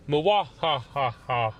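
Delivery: slow hammed-up villain cackle; evil laugh.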